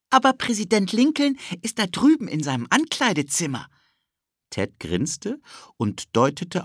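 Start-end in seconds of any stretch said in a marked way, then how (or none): 0.1-3.7 put-on high, eager little-boy voice; a pushy kid tugging at his friend; cheeky boy Ted. Ted pulls Philipp along, eager high boy voice.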